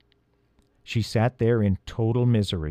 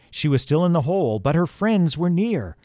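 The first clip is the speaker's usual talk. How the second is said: ordinary delivery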